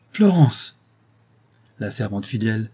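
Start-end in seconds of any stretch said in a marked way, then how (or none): 0.1-0.8 a high, loud cry of anger; angry cry. A narrator's reading. Rose cutting off her old servant with an angry cry. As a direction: snap her name to stop her. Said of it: "l'accent de colère qui accompagnait ce cri".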